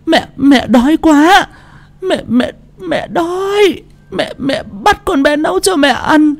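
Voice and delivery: very high, tearful stammered begging; frightened Bà Cẩm. A narrator's reading. Old mother begging her daughter-in-law for mercy, frightened. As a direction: beg and sob, stumbling over each word. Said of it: "Bà Cẩm run run nhìn con dâu".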